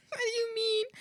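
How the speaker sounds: high mock-wail of disbelief; hammed-up high-pitched bewilderment; baffled protest. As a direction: whine it high, acting baffled. Acted disbelief at mom's accusation.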